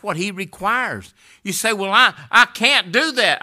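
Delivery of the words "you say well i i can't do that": high, loud whining protest; mock excuse. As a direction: shout the doubter's excuse back at them. Preacher mocks listener's excuse.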